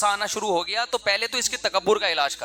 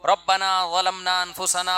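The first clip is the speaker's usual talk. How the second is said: slow recitation; slower than usual. Acted. Quoting Adam and Eve's prayer.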